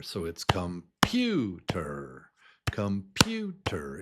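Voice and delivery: computer with the pu stretched and punched. Shown as a demonstration. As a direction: lean long on the middle of computer. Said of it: "It's louder and slower".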